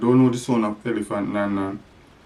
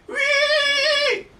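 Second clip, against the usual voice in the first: pig squeal. Shown as a demonstration. Pig sound for toddler.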